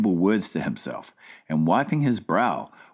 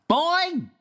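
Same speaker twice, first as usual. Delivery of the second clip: slow delighted giant's exclamation, higher; cried out; much slower than usual. Acted. Giant tasting the breakfast, delighted exclamation. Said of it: "he cried".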